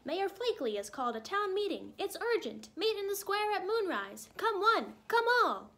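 high, loud heralding call; squawking albatross. A narrator's reading. Albatross calling the town to an urgent meeting, squawking. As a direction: cry the news out over the square. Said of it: "squawked the albatross".

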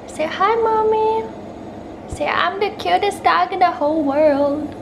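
high, loud pet-owner coo; baby talk. Pretend. Cooing at her dog Lola.

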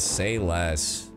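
usual speaking voice

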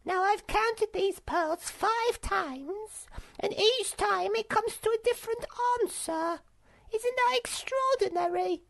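very high, squeaky creature voice; puzzled, pompous little monkey; self-important Silk Monkey. Acted. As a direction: report it importantly in a tiny high voice. Silk Monkey reports counting pearls.

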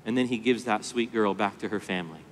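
ordinary talk

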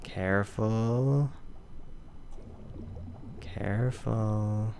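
very slow, soft, tense warning; drawn-out careful. Real, not acted. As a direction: stretch it out, holding your breath. Tense moment steering carefully.

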